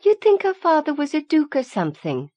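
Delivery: Mary mocking Rowan's family in class; smirking. A narrator's reading. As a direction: smirk through the taunt. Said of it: "Mary smirked".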